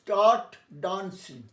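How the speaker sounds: "start dancing" spoken slowly with "start" stressed; teacher drilling "start dancing" slowly; slow, stressed model of "start dancing"; slow model phrase. Shown as a demonstration. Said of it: "আমার উচ্চারণ লক্ষ্য করো".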